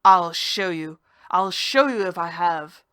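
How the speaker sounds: loud, defiant yell; shouting. Acted. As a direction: bellow the threat. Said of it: "I yelled".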